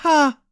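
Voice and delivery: loud, sharp single laugh; defiant laugh. Acted. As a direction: scoff defiantly. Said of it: "Cassian laughed defiantly".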